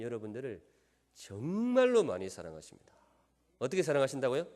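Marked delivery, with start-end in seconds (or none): 1.1-2.9 정말로 stretched long and loud; stress on 정말로. Real, not acted. Preacher hammers how much God loves. (정말로를 길고 힘 있게 강조)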